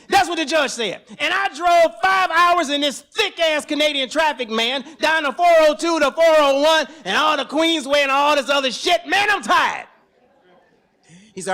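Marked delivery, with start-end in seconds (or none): 0.1-9.9 loud, high frustrated venting; exasperated rant. Retold or imitated. Father venting at police station, exasperated and loud. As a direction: let the frustration boil over, loud.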